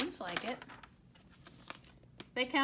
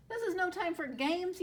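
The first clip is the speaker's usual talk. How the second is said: mock yell; 7 semitones higher than usual. A narrator's reading. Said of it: "yelled Goose".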